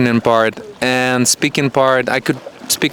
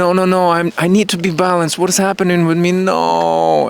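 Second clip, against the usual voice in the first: fast, higher, fretful inner voice; mock panic; 7 semitones higher than usual. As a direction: play the frantic self-talk of someone obsessed with balance. Mocking an anxious obsessive inner voice.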